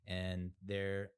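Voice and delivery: ordinary talk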